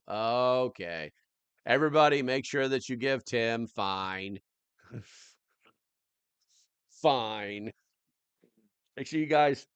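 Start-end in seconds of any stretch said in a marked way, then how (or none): 1.6-9.7 calling out. Real, not acted. Calling viewers to action.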